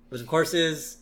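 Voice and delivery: usual speaking voice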